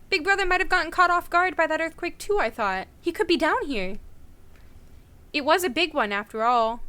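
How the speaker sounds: normal talking voice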